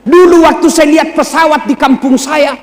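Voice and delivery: ordinary delivery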